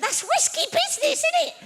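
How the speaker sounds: very high, squeaked warning; comic alarm. Acted. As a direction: squeal the doubt in mock worry. Comic alarm at virtue names.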